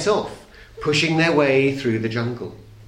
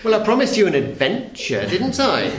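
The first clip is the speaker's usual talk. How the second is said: said with a laugh in the voice, higher; grinning; 6 semitones higher than usual. A narrator's reading. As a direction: grin through the words proudly. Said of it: "grinned Mister Daydream".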